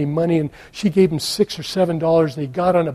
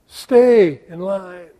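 slow command of God; 4 dB louder, much slower than usual. Acted.

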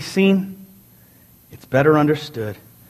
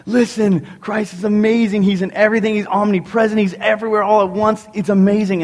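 eager witness; 6 semitones higher than usual. Retold or imitated. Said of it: "We tell them".

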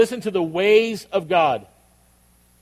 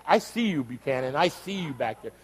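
normal talking voice

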